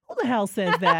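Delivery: incredulous question through a laugh; laughing disbelief. Real, not acted. Mother laughing at the midwife's claim, laughing disbelief.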